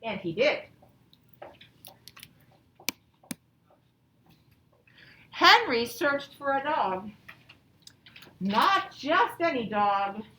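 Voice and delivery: slow, expressive read-aloud to a class; reading to children. Acted. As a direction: read it like a picture book to second graders. Teacher reads story aloud.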